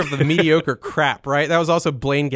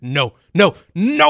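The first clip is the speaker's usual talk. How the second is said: loud no, building to a high wail; hammed-up anguished yell; re-enacted screaming; 5 semitones higher, 5 dB louder, slower than usual. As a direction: scream it in mock agony, stretching the last no. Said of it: "you hear me screaming in Rich's car".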